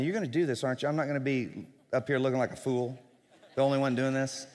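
ordinary talk

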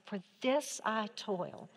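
hesitant, wondering aloud; tentative statement. Shown as a demonstration. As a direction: say it like you're still thinking it through. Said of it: "One more time".

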